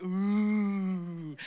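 long, low spooky "ooooh"; spooky "ooh". Retold or imitated. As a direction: draw out a low mock-eerie ooh. Mock-spooky group reaction to sign.

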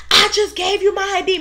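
high, angry customer yelling; imitating a shrill rude customer; mock screaming customer. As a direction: throw her complaint back, way up high. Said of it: "Mind you, she's screaming".

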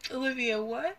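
imitating a baffled friend; incredulous friend. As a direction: act baffled by the word. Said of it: "She was like".

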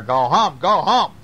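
loud repeated chant; playful storybook shout; baby bear shooing. Acted. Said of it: "says Baby Bear".

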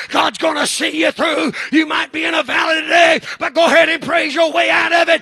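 rally them out of the valley; preaching at full force. Acted. Pastor urging the church to praise through trials, building shout. Rising sermon climax.

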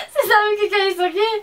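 high laughing tease; teasing, laughing. Real, not acted. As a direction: tease her through a laugh.

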